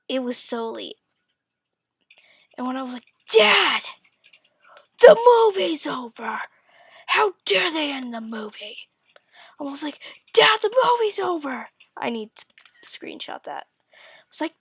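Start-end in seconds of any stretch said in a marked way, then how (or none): 3.3-8.9 loud high cry on the first word, then easing; mock outrage. Kid complains to dad about the ending, imitated outrage. Child outraged that movie ended. 10.3-11.7 put-on high, loud child's protest; mock outraged wail. Kid to dad as the movie ends, hammed-up outrage.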